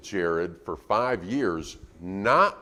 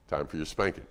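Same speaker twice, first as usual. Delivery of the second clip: low, soft, matter-of-fact announcement; unruffled parental verdict; calm parent; 6 semitones lower, 7 dB quieter than usual. Acted. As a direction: announce it quietly, without any anger. Parent calmly announces punishment without yelling.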